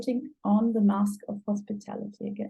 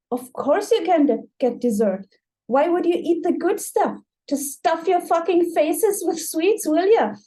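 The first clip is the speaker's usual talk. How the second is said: louder, higher sarcastic tirade; hostile outburst; 6 semitones higher, 3 dB louder than usual. Acted. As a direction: snap at the kids in mock fury. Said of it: "overdramatic a hostile way".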